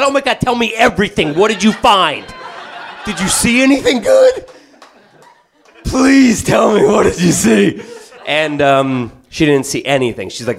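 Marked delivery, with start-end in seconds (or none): 0.0-7.8 much higher, laughing, pleading; giddy eager. Retold or imitated. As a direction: beg for the juicy details. He eagerly questions wife.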